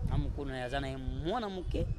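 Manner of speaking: ordinary talk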